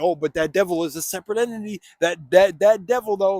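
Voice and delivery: loud, whiny mock protest; mocking people's excuse. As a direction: sneer at their excuse, loud. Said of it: "But then people be like".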